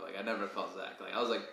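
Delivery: ordinary talk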